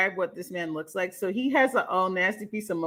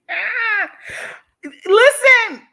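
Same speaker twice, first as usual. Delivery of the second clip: excited shout; 11 semitones higher, 9 dB louder, much slower than usual. Real, not acted. Bursting to tell chat.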